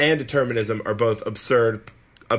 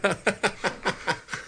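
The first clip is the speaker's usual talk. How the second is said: quiet giggle, pitched up; soft high laugh; 8 semitones higher, 6 dB quieter than usual. Real, not acted. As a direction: let a light laugh slip. Laughing at joke.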